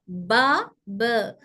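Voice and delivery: normal talking voice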